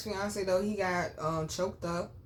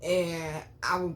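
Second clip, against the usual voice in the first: ordinary delivery, nothing marked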